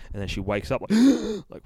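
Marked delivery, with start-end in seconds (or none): 0.9-1.4 a high, sudden waking gasp; sharp gasp. Retold or imitated. She wakes from the nightmare with a gasp. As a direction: gasp awake in fright.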